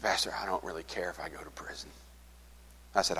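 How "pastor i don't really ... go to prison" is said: fast, unvoiced whisper of giving up; resigned whisper. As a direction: whisper it quickly, past caring. [name] giving up, telling pastor.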